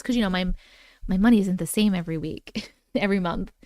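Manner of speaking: ordinary talk, nothing marked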